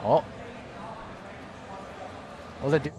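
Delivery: ordinary delivery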